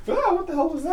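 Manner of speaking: high startled squeal, imitating a patient; startled yelp. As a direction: squeak it out like a sudden fright.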